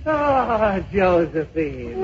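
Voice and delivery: soft, slow, hammed-up lover's sigh; mock tender. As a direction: draw out the beloved's name softly. Mock lovers' reunion in sketch.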